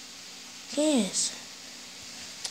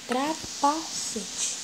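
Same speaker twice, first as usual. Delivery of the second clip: Trapacete stretched very slowly; drawn-out word; much slower than usual. Shown as a demonstration. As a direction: drag out Trapacete syllable by syllable. Playing with a made-up word.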